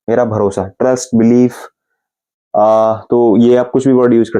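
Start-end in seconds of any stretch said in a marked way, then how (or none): none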